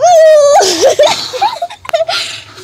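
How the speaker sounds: very high, loud shriek of laughter. Real, not acted. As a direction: squeal with laughter. Waking brother up.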